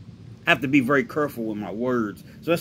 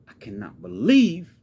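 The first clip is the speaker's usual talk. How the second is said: incredulous rant, building higher; angry and hurt; 7 semitones higher, 3 dB louder than usual. Acted. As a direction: vent disbelief at being betrayed. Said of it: "He's angry. He's, he's, he's hurt".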